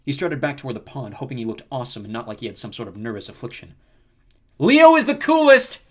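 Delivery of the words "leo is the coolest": high, drawn-out boastful yell; boastful shout. A narrator's reading. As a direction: shout it slowly to a whole crowd. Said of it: "he shouted".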